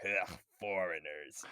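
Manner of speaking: slow, disgusted grumble in a put-on voice; grumpy old man. Imitating the old man watching a foreign film, slow disgusted grumble. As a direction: grumble it slowly like a cranky old neighbor.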